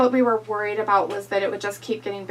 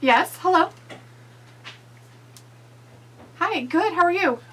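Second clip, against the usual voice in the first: phone voice; 8 semitones higher, 4 dB louder than usual. Real, not acted. Answering phone call from vet.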